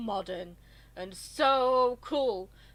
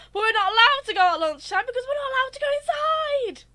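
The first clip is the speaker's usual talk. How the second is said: louder, higher exasperated rant; 10 semitones higher, 6 dB louder, faster than usual. Real, not acted. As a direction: vent the frustration. Ranting about school rules.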